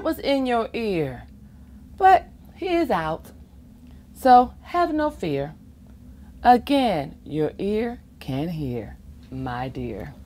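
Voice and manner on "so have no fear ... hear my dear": plain reading voice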